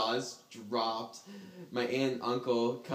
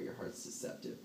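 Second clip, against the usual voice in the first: whispered aside; 12 dB quieter than usual. Real, not acted.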